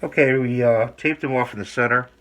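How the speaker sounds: muffled voice through a mask; through a face mask. Real, not acted. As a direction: speak through a breathing mask. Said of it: "I'm talking funny because I got a mask on".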